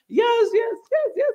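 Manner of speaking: high-pitched, gushing yeses; eager yes-man. Retold or imitated. An African leader eagerly agreeing with America, high-pitched and gushing. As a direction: gush agreement like a flattered leader.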